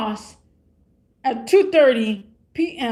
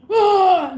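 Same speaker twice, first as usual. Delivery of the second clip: mock scream; 8 semitones higher, 6 dB louder than usual. Imitating a stranger freaking out.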